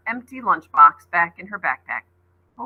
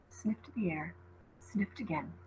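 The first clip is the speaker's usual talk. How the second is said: whisper; 15 dB quieter than usual. A narrator's reading. Narrating the beast sniffing.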